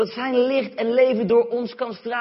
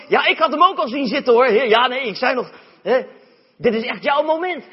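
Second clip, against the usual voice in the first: fawning friends; 3 semitones higher, 9 dB louder than usual. Retold or imitated. Fawning crowd suddenly friendly.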